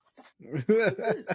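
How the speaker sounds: high, laughing mock disbelief. Retelling waking up after dying, high laughing disbelief. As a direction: squeak it out through a laugh. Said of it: "I'm like".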